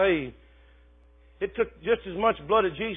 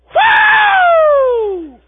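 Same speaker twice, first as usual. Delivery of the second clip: high, loud ecstatic whoop; joyful whoop; 15 dB louder than usual. Retold or imitated. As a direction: let out a church shout. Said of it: "Brother Delbert would just go".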